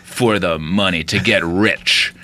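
low, slow, matter-of-fact villain; lightly voiced cold villain, unhurried; Brian Cox line. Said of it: "And he's like".

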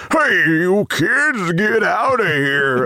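put-on slow, high creaky old man voice. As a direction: shout it like a cranky old security guard. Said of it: "somebody in a booth doing an old man voice".

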